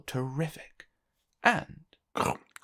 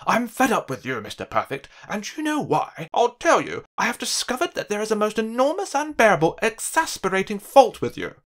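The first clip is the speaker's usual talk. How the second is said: higher and louder, indignant tirade; angry rant; 6 semitones higher, 7 dB louder than usual. Acted. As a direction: scold him, building outrage. Uppity rants at Perfect.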